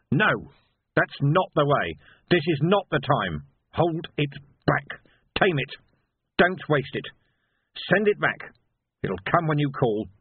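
put-on restrain the boy with your voice; firm commanding shout. A narrator's reading. Vimes stops Sam's attack.

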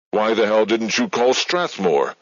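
furious demand. A narrator's reading. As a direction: let the anger rise through the question.

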